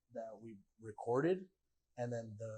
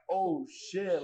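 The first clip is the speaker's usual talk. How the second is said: excited; 5 semitones higher, 5 dB louder than usual. Retold or imitated. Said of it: "I remember I was shaking".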